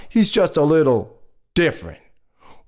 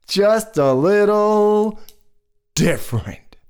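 stretched slowly, higher-pitched; drawn-out hedging; 5 semitones higher, slower than usual. Acted. Storyteller picks a kind word for neighbours, drawn-out hedging. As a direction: hold the words out, searching for tact. Hesitating before the tactful word.

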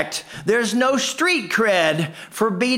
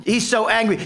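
ordinary delivery

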